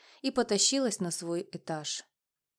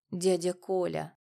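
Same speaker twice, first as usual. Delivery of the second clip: plain reading voice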